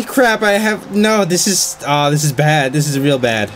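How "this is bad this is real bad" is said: normal talking voice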